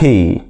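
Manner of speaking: "بي" as English "pee" with a puff; aspirated P. Shown as a demonstration. Teacher finishing the P drill, "بي" said as aspirated "pee". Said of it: "بتعمل صوت ال P".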